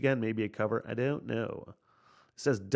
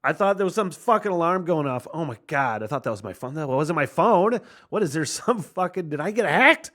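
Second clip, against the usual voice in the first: real startled outburst, higher and louder; alarmed surprise; 6 semitones higher, 6 dB louder than usual. Real, not acted. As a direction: burst out in rising confusion, pitch jumping up. Startled by alarm-like sound in song.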